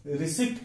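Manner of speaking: रिसिप्ट with an audible p; wrong pronunciation. Shown as a demonstration. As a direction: say रिसिप्ट as ri-SIPT. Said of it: "गलती से उच्चारण".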